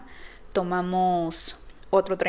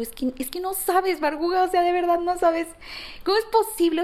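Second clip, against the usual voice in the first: ecstatic; 10 semitones higher than usual. Retold or imitated. Telling her mentor how much she means, high and ecstatic. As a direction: pour it out overwhelmed with joy. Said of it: "extasiada de felicidad".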